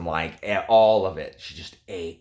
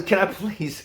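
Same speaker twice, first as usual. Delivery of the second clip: normal talking voice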